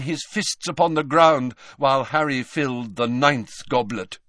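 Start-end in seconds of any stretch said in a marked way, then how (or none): none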